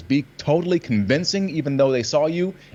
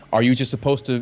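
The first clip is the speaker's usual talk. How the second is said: normal talking voice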